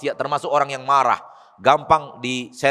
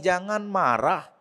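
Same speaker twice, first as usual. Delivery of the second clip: slow, stretched-out reply; measured counsel; 2 semitones higher, 3 dB quieter, slower than usual. Retold or imitated. The Prophet repeats his advice to the man, slow measured counsel. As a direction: answer patiently, drawing each word out. Prophet gives the same answer.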